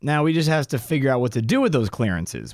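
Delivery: usual speaking voice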